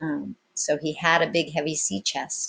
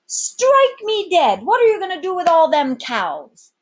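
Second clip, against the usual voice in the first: astonished sailor; 13 semitones higher, 10 dB louder than usual. Acted. Sailor astonished by the captain's plan, very high and loud. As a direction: bellow in disbelief.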